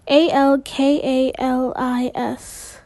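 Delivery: slow letter-by-letter spelling; measured, separate letters. Acted. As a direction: spell it out slowly, one letter at a time. Spelling out the word.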